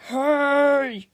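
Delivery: loud, high angry holler; coach's shout. Acted. Coach yells at the whole field, loud angry holler. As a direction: bellow like an outraged coach. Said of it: "shouted the coach".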